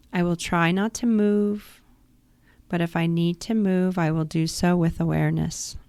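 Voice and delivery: ordinary delivery, nothing marked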